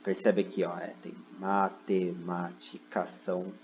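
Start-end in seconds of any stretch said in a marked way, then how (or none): 1.4-3.1 "matemática" broken slowly into five syllables. Shown as a demonstration. Teacher showing students five syllables, "matemática" said syllable by syllable. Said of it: "cinco sílabas".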